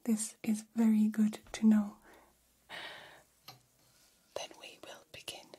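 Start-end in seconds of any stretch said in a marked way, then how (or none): 4.4-5.4 hushed, quick whisper; barely audible ASMR whisper. Acted. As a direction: drop right down into a whisper. ASMR roleplay, drops to whisper.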